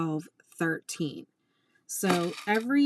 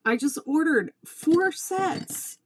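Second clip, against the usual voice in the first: slow, high, louder exasperation; high, slow and emphatic, really fed up; exasperated; 9 semitones higher, 4 dB louder, slower than usual. Real, not acted. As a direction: spell out the outrage to the audience. Exasperated complaint to viewers.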